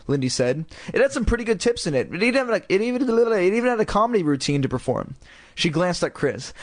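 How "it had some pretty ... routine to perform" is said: put-on high girl voice. Lindy bragging.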